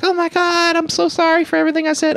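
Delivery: very high, fast, breathless mock apology; overacted high-pitched apology rushed out in one breath; hammed-up gushing apology. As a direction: squeal the apology out in a put-on voice. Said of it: "and they're like".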